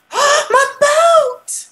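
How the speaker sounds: gasping, very high falsetto squeal; delighted squeal. Pretend. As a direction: greet a long-lost thing in a gushing falsetto. Greets regained boat with delight.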